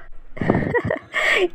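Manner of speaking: high, soft giggle; airy high snicker; mocking laugh. Shahd snickering at Yousef's hint, amused.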